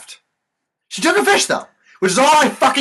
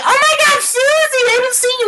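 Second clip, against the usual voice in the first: much higher squealed greeting; gushing old lady. Retold or imitated. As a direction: squeal it like an excited old woman. Imitating gushing old women.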